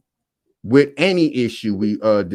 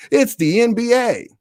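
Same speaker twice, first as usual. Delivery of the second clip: pitched way up in mock hype; mock hype, higher than speech; sarcastic announcer; 12 semitones higher than usual. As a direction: sell it like a fake ad, dripping sarcasm. Sarcastic praise of the NBA.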